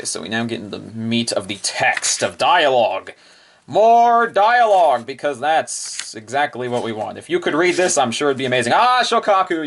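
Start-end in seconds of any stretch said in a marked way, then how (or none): 3.7-5.1 drawn-out, high sarcastic cheer; mock enthusiasm. Real, not acted. Reader teasing the wordy pages, sarcastic cheer. Mock delight at more text. 8.7-9.7 high delighted cry of a name; light up at seeing her; excited. Real, not acted. Fan spotting her favourite on the page, high and excited.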